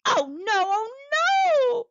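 high, wailing moan of dismay. A narrator's reading. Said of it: "Winnie moaned".

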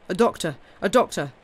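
loud urgent cry for a doctor. A narrator's reading. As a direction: shout it in panic. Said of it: "he cried".

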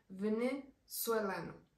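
"Venezolano" drawn out slowly as "ve-ne-zue-la-no"; Portuguese venezuelano. Shown as a demonstration. Teacher repeating the Portuguese demonym slowly for learners. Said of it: "sí suena bastante distinto".

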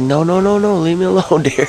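high, panicked pleading; mock panic, begging the deer to leave; freaking out. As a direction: squeal it like you're terrified of the deer. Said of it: "I'm freaking right out".

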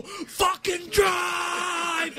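high, drawn-out mock scream. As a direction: stretch the last word into an enraged yell. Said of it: "he starts screaming".

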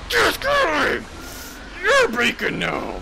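spring the trap with a triumphant cackle-shout; hammed-up villain gloat; gleeful mock shout. Player taunts then kills.

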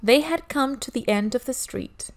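plain reading voice

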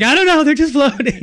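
high, loud, mock-panicked outburst; exasperated yell. Asked again about his hands, a high exasperated yell.

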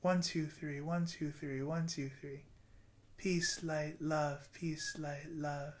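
soft, slow counting and breathing cues; guided meditation. Acted. Vlogger guiding viewers through a breathing meditation, softly. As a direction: lead the breath gently, counting.

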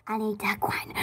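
whispered to herself; hushed aside to herself. Real, not acted. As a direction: breathe it under your breath.

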